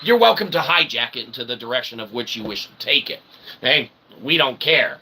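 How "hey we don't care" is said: ordinary talk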